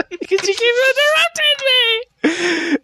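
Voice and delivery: very high, slow mock wail; exasperated whine. Complaining to co-hosts about interruptions, high exasperated wail.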